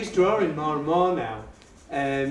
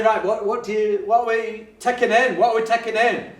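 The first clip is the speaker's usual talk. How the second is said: higher, louder, eager to the class; rally the class with a keen question; bright teacher question; 6 semitones higher, 6 dB louder than usual. Retold or imitated. Teacher quizzing the kids, higher and louder.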